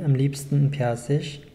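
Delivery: ordinary talk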